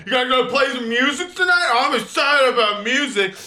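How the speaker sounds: high, loud dopey dudebro voice; mocking the bro. Big guy chatting up high schoolers, dopey mock bro voice. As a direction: play the big dude as a dim, eager bro. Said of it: "he's like".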